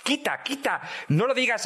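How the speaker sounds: a hint of an impatient Agustín; imitating Agustín. Auron quoting Agustín stopping him. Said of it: "Me decía".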